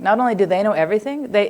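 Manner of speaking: usual speaking voice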